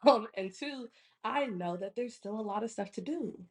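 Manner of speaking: usual speaking voice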